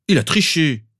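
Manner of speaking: high, loud indignant accusation; aggrieved outburst; protesting. A narrator's reading. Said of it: "protesta l'autre Harry".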